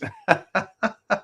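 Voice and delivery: soft, high chuckle; laughing. Real, not acted. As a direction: break into quiet giggles at your own joke. Laughs at own height joke.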